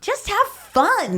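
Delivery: very high, drawn-out mock cheer; cute encouraging coach. Imitating Hal urging his team, high mock cheer. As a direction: sing out slow, high-pitched encouragement.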